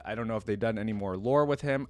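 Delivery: normal talking voice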